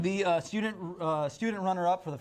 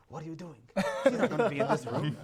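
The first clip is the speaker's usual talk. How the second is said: put-on German accent. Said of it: "That was not a German accent".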